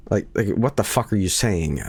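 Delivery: normal talking voice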